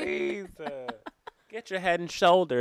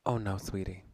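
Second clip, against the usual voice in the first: mock loving; 4 semitones lower than usual. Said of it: "and so lovingly said".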